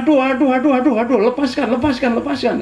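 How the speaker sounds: high, loud mock cries for release; imitated pained yelling. Captured spirit begs the preacher to let go, imitated yelling. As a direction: yelp like a captive begging, acted out. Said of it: "Penghulu situ teriak-teriak".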